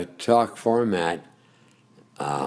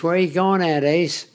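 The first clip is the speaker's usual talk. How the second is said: higher, louder imitated Baltimore cab driver; retold Baltimore cabbie accent; 11 semitones higher, 4 dB louder than usual. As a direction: call out like a Baltimore cabbie greeting a fare. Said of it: "I loved the Baltimore accent".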